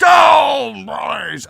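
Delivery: high, very loud sports holler; mock touchdown shout. Podcaster riffing on offense and defense, shouting in triumph. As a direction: holler it like a playful touchdown call.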